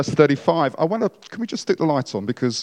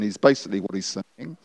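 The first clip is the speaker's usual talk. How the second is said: ordinary talk, nothing marked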